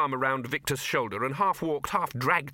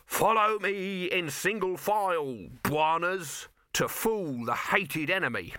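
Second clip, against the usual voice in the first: declaimed card text; 7 semitones higher, slower than usual. A narrator's reading. Dibbler declaims an invented title card.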